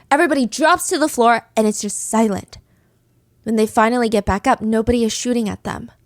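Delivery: ordinary delivery, nothing marked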